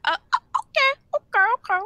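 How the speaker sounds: high, loud stammered okays; mock taken-aback okay. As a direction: act out exaggerated disbelief at her answer. Acted comeback in retold quarrel.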